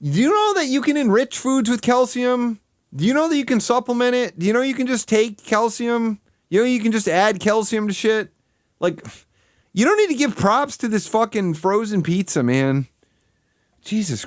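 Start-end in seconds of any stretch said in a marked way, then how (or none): 0.0-10.9 raised, incredulous scolding, pitched higher; exasperated rant. Real, not acted. Ranting at the reviewer on screen, exasperated and higher pitched. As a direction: berate him for not knowing basics.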